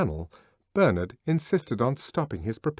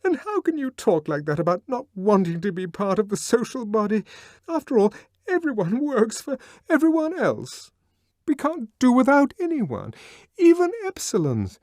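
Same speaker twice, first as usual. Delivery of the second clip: much higher, louder, upset; Lenina's distressed voice; 14 semitones higher, 6 dB louder than usual. A narrator's reading. Lenina to Bernard, much higher and upset. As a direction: protest it high and hurt.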